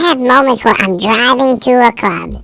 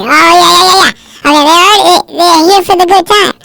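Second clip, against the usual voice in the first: higher, hurried yes-yes agreeing; eager agreeing self; 7 semitones higher, 3 dB louder than usual. Retold or imitated. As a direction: nod along too eagerly to calm down. Voicing his own eager self.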